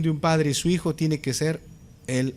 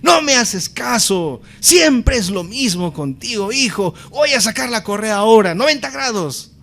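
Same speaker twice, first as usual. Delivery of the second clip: higher, louder scolding of a disobedient son; mock angry yelling; 7 semitones higher, 6 dB louder than usual. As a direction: play the exasperated dad yelling at his kid. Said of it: "estamos ahí tal vez gritando". (padre gritándole enojado al hijo desobediente, agudo y fuerte)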